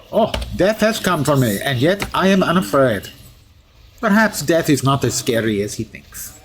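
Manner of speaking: hammed-up fake Russian accent, pitch often leaping up; musing steel hero with a mock Russian accent; deliberately bad Russian accent. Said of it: "bad Russian accent".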